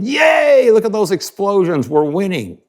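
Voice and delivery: high, far-off mock cheer of a cheering crowd; sing-song jeer at people cheering a war. A cheering crowd mocked as cheering war, high mock cheer. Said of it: "And everybody's going".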